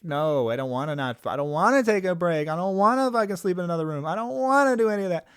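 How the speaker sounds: whiny protest building from a mild whine to a high loud wail; mock toddler tantrum climbing to a loud wail. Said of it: "is a toddler about anything".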